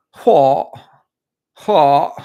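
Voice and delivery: very loud, high exclamation in imitation; Jamie from Ted Lasso. Host imitating a favorite show character, loud and high. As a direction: shout it high as a TV character impression. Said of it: "I like Jamie from Ted Lasso".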